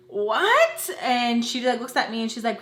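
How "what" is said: high, rising incredulous what; mock shock. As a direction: pitch it up in disbelief. Retold shocked reply to friend.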